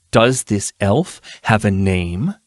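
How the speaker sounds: slow, deliberate threat; cold interrogator. Acted. Erilyn slowly presses her captive, cold and deliberate. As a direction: press him slowly and coldly.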